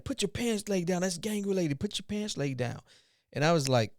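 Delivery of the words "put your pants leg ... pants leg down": higher, insistent command; cop's curt order. Retold or imitated. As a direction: keep pressing the order on him. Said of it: "He's like".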